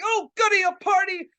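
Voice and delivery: high, childlike delight, acted out; giddy glee. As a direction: squeal it like you're joining a party. Reenacts naive glee before the fumes.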